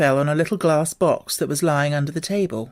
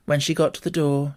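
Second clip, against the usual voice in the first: ordinary delivery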